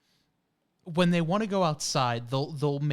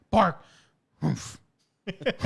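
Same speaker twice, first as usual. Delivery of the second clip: mock dog bark and huffs; slower than usual. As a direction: bark once, then drop to huffs. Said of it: "he'll just go".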